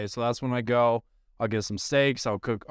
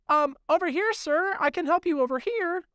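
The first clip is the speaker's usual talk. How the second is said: much higher pipsqueak nerd imitation; mock the eager little clerk, pitched way up. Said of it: "he goes".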